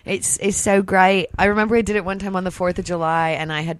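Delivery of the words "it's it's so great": put-on Australian accent; casual fake Australian. Host re-enacting drunk party trick, fake Australian accent. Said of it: "tell people I was from Australia".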